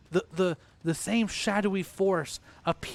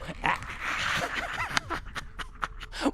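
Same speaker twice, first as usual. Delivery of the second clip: high, breath-filled evil cackle; creepy pumpkin cackle; 4 dB quieter than usual. Acted. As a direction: cackle long as a spooky pumpkin.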